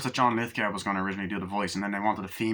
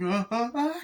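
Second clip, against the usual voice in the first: mock screams; 13 semitones higher than usual. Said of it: "she's like".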